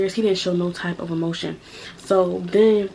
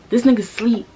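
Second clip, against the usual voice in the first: loud, high, indignant blurt; raised, incredulous outburst; outraged exclamation; 4 semitones higher, 6 dB louder than usual. Real, not acted. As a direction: spit it out in disbelief. Outraged telling of boyfriend asleep.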